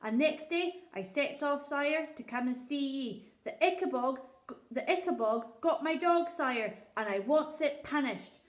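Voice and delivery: a poor shepherd pleading before his king; rustic old shepherd. Acted. Shepherd tells his tale.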